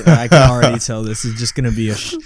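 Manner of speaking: usual speaking voice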